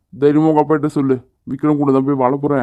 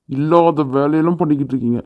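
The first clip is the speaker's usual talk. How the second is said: normal talking voice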